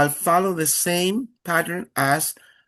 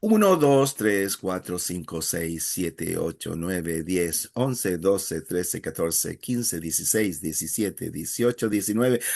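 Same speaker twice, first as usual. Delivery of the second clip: counting on one breath; 8 semitones lower than usual. Shown as a demonstration. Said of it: "what I would love to hear is".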